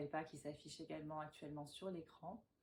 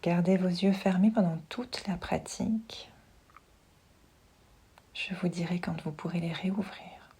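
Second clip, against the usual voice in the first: guided meditation; 14 dB louder than usual. Acted. Yoga teacher settling listeners lying down with eyes closed, hushed meditation voice. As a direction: guide them gently into relaxation, unhurried and close.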